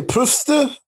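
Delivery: "Pufste" for Pfizer, starting with puf, ending in ste; mispronounced Pfizer. Real, not acted. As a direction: stumble on the name and say "Pufste". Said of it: "that's not how you say it".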